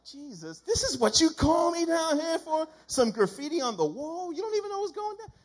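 high, fast mock exasperation; exasperated outburst. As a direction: sound fed up and disbelieving. Exasperated at being called out.

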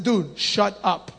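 low, slow stern order. Retold or imitated. Preacher voicing crowd silencing blind man, stern low rebuke. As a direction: say it slowly and low, brooking no argument. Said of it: "people told him sternly to shut up".

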